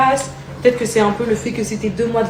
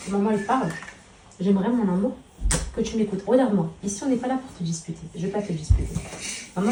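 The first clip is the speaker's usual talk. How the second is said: calm him so he listens; gentle mother talk; 10 dB quieter than usual. Real, not acted. Mother talks softly to her son about school.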